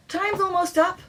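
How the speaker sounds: high, loud warning call; cried out. A narrator's reading. Scottish player calling that time is up, high and loud. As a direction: call the time across the field. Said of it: "one of the Scottish team cried".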